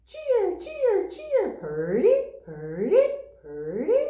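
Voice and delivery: very high cheers, then loud rising purdys; high cheer calls and rising purdy whistles; cardinal song. A narrator's reading. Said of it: "Cardinal wears a pointy hat".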